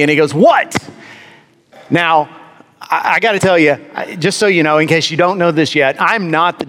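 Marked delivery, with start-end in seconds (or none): 0.3-0.8 high, abrupt mock-angry question; glowering man. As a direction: imitate an irritated man demanding an answer, pitch shooting up. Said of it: "he's standing there glowering down at me".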